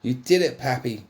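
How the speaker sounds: high, loud happy scream. Acted. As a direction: scream it in delight. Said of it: "Pepper screams".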